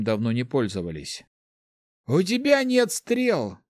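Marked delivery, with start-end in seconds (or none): 2.1-3.6 necromancer. A narrator's reading. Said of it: "заметил Вильгельм".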